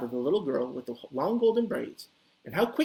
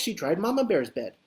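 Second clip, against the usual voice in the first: ordinary delivery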